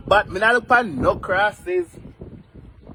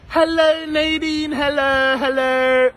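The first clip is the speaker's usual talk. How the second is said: calling out; 5 semitones higher, slower than usual. Real, not acted. Calling out to a viewer.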